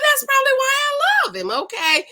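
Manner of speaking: high, loud gushing squeal; excited squeal. Real, not acted. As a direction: squeal it out like a smitten fan. Gushing over her favourite contestant.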